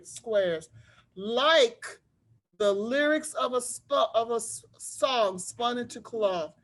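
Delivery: put-on lively read-aloud voice for children; story narration. A narrator's reading. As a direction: tell it like a storybook to a class. Teacher reads picture book aloud.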